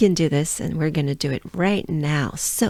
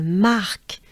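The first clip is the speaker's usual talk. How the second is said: French accent. Shown as a demonstration. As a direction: Mark with a French breathed R. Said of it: "with a French accent".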